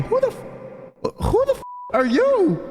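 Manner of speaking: very high, squeaky incredulous voice; mock disbelief. People in line demanding who he is, incredulous. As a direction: imitate baffled onlookers, voice leaping up.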